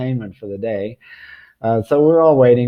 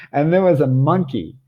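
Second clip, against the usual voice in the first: ordinary delivery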